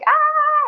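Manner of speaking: very high, sustained cry of delight; excited squeal. Retold or imitated. Imitating a man delighted to meet an Irish speaker, high squeal. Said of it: "he was so excited".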